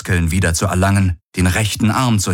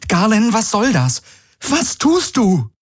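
much higher, alarmed questions. A narrator's reading. Kirai sees Galen sedate Gaurus, alarmed. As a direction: cry out in disbelief.

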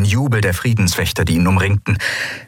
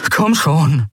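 higher, pressing urging; spurring on; 7 semitones higher than usual. A narrator's reading. Kai spurring on the eidolon, urgent raised voice. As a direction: egg on a helper. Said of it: "spornte er das Eidolon an".

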